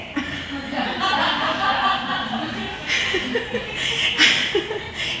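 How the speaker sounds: a long high giggle, bubbling over; gleeful laugh. Real, not acted. Laughing at the nurse who is about to be surprised, gleeful giggle. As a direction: laugh as if you know the punchline.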